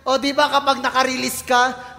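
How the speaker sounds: usual speaking voice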